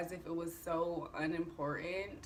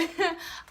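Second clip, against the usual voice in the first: giggle; 10 semitones higher, 5 dB louder than usual. Real, not acted. Laughs at her own height.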